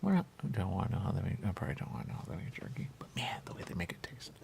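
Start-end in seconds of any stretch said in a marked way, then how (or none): 1.5-4.3 whispered aside. Real, not acted. Confiding about jerky making.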